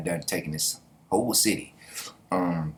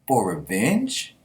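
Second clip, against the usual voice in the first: slow "For revenge?" with a rising question at the end; questioning tune; much slower than usual. Retold or imitated. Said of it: "he got a question mark at the end".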